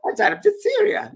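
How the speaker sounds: very high, loud child impression; little girl. Little girl tells Mary how she died, high child voice. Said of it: "And the little girl goes".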